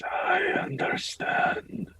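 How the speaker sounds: slow whispered priest voice; murmuring priest. Acted. Priest agrees to bury the dead, slow whispered murmur. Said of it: "He kind of murmurs something himself".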